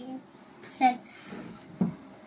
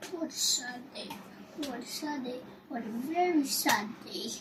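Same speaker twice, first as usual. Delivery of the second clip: repeated soft murmur, even softer; 10 dB quieter than usual. A narrator's reading. Sad man repeating his lament on every walk, hushed murmur. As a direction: keep muttering the sad words under your breath. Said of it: "say, murmuring".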